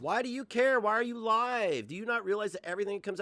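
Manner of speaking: high, loud, exasperated questioning; exasperated challenge. Real, not acted. Streamer demanding why she is live, exasperated. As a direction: fire the questions at her, voice pushed right up. Challenging the woman being watched.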